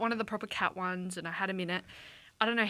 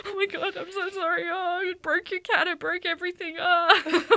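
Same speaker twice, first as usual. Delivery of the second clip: higher, half-crying apology; mock tearful panic; 8 semitones higher, 4 dB louder than usual. Apologising to the cat owner, in hammed-up tearful panic. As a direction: wail the apology like you broke everything. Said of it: "here's me like".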